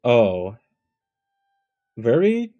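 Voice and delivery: ordinary talk, nothing marked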